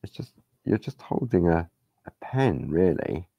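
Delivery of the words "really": normal talking voice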